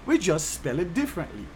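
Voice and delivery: ordinary talk, nothing marked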